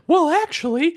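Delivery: high, loud put-on hero voice; mock interjection. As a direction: pipe up in a squeaky imitation. Imitating Deku interjecting.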